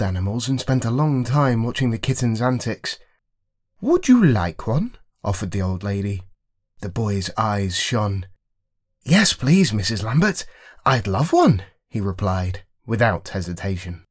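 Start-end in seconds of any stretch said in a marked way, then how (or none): 3.8-5.0 put-on high, kindly old lady voice; old lady offering a kitten, lifted high. Old lady offers the boy a kitten, high and sweet. 9.0-11.6 lightly voiced eager boy, a bit higher; boy accepting at once, bright and keen. Boy answers the old lady, lightly voiced eager child.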